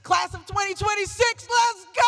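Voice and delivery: high-pitched rallying yell, building to a long final word; excited shout. Real, not acted. Speaker rousing the graduating class, real excited shout. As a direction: whoop it out to fire up the crowd. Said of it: "I get excited at graduation".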